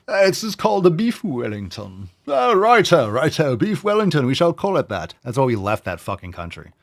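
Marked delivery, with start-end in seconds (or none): 0.1-5.1 high, hammed-up British accent; mock a fussy Brit in a high British voice; British person. British diner naming beef Wellington, hammed-up British accent. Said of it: "some British person to tell me".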